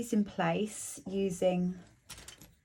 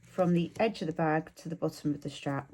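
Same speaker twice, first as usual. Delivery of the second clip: talking around a pin in the mouth. Real, not acted. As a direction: mumble with a pin held between the lips. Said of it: "I'm talking funny 'cause I've got a pin in my mouth".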